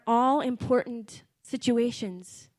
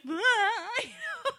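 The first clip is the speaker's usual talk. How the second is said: 1 mock sob laugh; 12 semitones higher than usual. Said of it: "we're both like".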